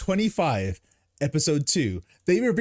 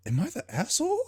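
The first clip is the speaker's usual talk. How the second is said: higher, softer "am I the one at fault"; sheepish quoted question; 6 semitones higher, 5 dB quieter, faster than usual. Retold or imitated. Retelling a brother's look at him, high soft doubt. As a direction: ask it in a small, raised, doubting voice. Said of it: "looked at me just like".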